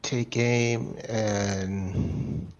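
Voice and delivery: ordinary delivery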